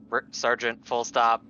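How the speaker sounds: usual speaking voice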